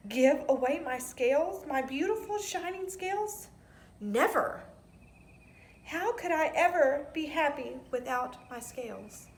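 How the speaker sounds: high, loud outraged protest; mock-outraged cry of never; indignant refusal. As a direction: refuse with wounded pride.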